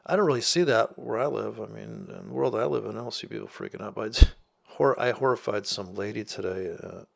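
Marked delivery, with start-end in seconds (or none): none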